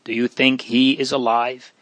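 ordinary delivery, nothing marked

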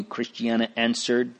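usual speaking voice

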